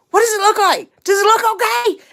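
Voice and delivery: high, loud imitation of a fussy husband; earnest fretting. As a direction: demand a verdict on the outfit. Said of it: "he's serious".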